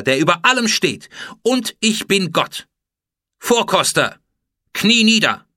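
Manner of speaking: loud, high imperious pronouncement; threatening. A narrator's reading. Pharaoh confronts the captured food taster, loud menace. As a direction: pass judgement like an enraged god-queen. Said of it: "Drohend sagte Hatschepsut zu ihm".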